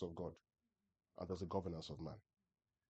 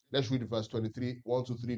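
ordinary talk

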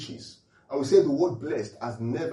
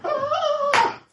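high, loud hammed-up squeal; mock excited shriek; 6 dB louder, much slower than usual. Preacher acts out a fan spotting the concert, mock squeal.

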